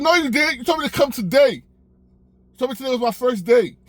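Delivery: high and indignant, flatly denying; high mock-outraged protest; brazen liar. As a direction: insist like a shameless liar. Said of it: "like a liar would".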